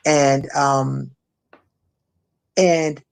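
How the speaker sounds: ordinary talk, nothing marked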